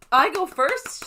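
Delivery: high, loud squeal; excited squeal. Real, not acted. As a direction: squeal it with glee. Excited at winning.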